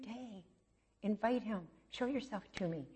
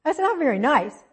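high, louder mock offense; a playfully wounded complaint; indignant; 7 semitones higher, 7 dB louder than usual. Woman grumbling at God's command, higher and louder.

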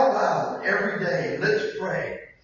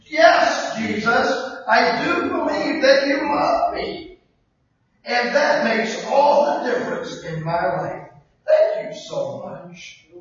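louder, slow prayer, yes shouted; praying out loud; 2 semitones higher, 5 dB louder, slower than usual. Acted. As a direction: pray it aloud with conviction. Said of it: "let's pray out loud every day".

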